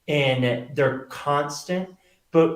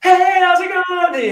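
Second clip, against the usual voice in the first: very high, loud, put-on cheer; hammed-up bright hello to a crowd; mock-cheery stage greeting; 11 dB louder than usual. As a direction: force a big showbiz greeting over heartbreak. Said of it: "And I'm like".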